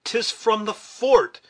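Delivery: high, drawn-out cry of discovery; excited exclamation. A narrator's reading. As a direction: exclaim it loud and slow. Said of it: "exclaimed Hawkeye".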